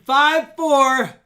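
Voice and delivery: shouting the countdown; loud countdown. Real, not acted. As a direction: call it out big and loud. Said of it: "I've always been loud and crazy".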